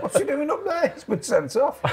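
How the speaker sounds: put-on high, laughing Uruguayan-accented manager; laughing send-up of a Uruguayan manager; mock Uruguayan accent. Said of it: "You can do the accent better than me".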